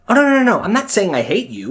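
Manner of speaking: mock denial.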